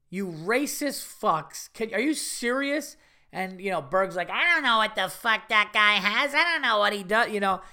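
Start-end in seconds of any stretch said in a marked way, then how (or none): 0.1-3.0 higher, drawn-out incredulous scolding; mock outrage. Comedian scolding friends for going in to wash, incredulous. 4.3-7.3 high, loud mock-panicky excuse; defensive protest. Imitated friend defending his hand washing, loudly defensive.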